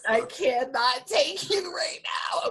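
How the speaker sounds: loud, high, drawn-out mock exasperation; laughing exasperation. Real, not acted. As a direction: cry it out, high and stretched, half laughing.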